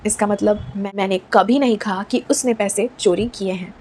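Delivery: ordinary talk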